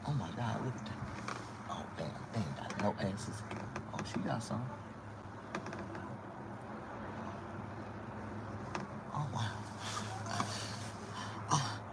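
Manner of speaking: soft, hushed muttered aside. Retold or imitated. Comedian murmuring to himself about what he sees, soft and hushed. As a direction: drop to a hushed side comment.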